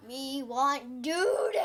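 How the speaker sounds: slow, hammed-up creature growl-shout; monster voice. Child voices the toilet monster, slow mock roar. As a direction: drag it out like a hungry monster. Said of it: "It's gonna go like".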